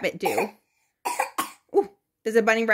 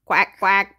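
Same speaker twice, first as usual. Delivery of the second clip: duck quack; 3 semitones lower, 4 dB louder than usual. Shown as a demonstration. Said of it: "What does a duck say?".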